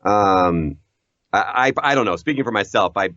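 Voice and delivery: ordinary talk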